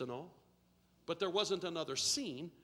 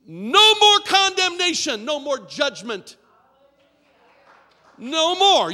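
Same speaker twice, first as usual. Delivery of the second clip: much louder, slow, hammering each "No more"; preaching at full force; 4 semitones higher, 18 dB louder, slower than usual. Acted. Preacher to his church, much louder in slow preaching cadence. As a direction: shout it over the congregation like a proclamation.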